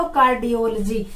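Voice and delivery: कार्डियोलॉजी as car-di-OL-jee; corrected pronunciation. Shown as a demonstration. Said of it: "क्या बोलेंगे इसको?". (कार्डियोलॉजी को कार्डियोल-जी बोलकर)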